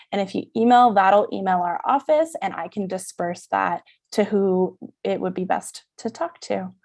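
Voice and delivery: ordinary talk, nothing marked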